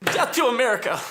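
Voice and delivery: high, fervent rallying cry; fanatical slogan. Acted. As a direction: throw it out as a zealot's war cry. Militant bursts out with slogan.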